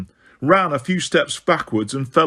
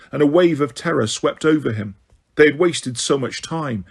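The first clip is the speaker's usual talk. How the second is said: usual speaking voice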